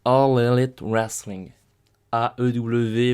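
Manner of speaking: slow, drawn-out ring announcer call. Acted. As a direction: announce it like a ringside MC. Announcer intro of the show. (voix d'annonceur de catch)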